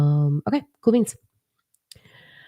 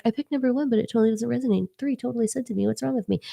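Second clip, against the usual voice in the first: mock confused viewer; 7 semitones higher, 4 dB quieter, faster than usual. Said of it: "And if you're like".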